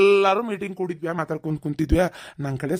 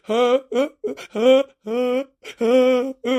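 mock wailing; 3 semitones higher than usual. Husband wails after wife's scolding.

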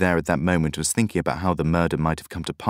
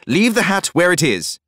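high, loud bellow; 11 semitones higher, 5 dB louder than usual. A narrator's reading. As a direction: bellow the order sharply. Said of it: "he bellowed".

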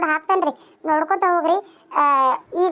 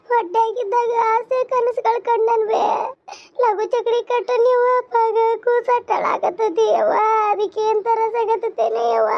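higher-pitched, drawn-out lament; wailing distraught mother; 5 semitones higher than usual. Acted. Mother lamenting to her elder over her endangered baby, wailing. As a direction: wail it out as a grieving mother.

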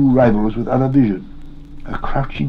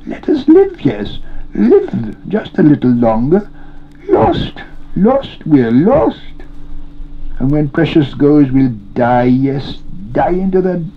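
high, wailing creature voice; tearful begging, pitch leaping high; weeping Gollum; 7 semitones higher, slower than usual. A narrator's reading. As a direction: wail and plead pitifully. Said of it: "he wept".

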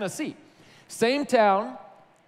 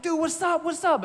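high-pitched, excited retold hello; eager greeting; 10 semitones higher than usual. Went up to his old best friend, eagerly greeting. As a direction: greet a long-lost buddy in an upbeat high voice.

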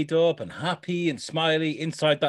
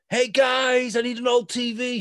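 put-on high, bright-eyed quirky girl; a chirpy quoted Darcy, much higher and louder; 11 semitones higher, 4 dB louder than usual. Friend retelling Darcy's entrance, high chirpy voice. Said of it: "and she's like".

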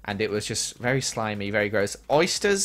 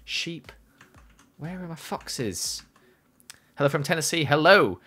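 ordinary delivery

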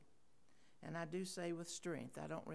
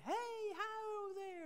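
Richard's happy, strong call. Retold or imitated. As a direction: call a cheery hello across the yard. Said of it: "this strong, happy sounding, deep voice".

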